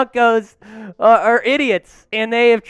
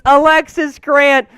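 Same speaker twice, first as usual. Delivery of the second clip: ordinary talk, nothing marked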